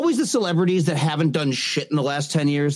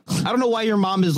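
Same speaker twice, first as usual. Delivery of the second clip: normal talking voice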